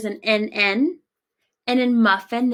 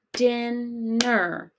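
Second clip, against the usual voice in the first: Dinner drawn out as din... ner; syllable break; 5 dB quieter than usual. Shown as a demonstration. Teacher puzzling over the break, Dinner in slow syllables. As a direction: split Dinner slowly into two beats. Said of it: "where we would break these words into syllables".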